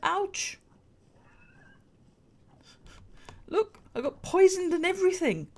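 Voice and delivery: high startled ouch; being chased. Real, not acted. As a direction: yelp then excited high complaint. Hit and poisoned while chased.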